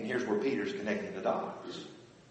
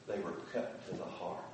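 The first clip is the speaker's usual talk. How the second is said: much softer and lower, grave; hushed solemn quote; 6 semitones lower, 10 dB quieter than usual. Retold or imitated. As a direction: read it as a heavy blow. Quoting scripture, grave.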